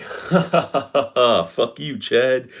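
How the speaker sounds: a mild, mean fake laugh, then a jab; lightly mock the cackle before cursing. Mocking the ex-partner's laugh.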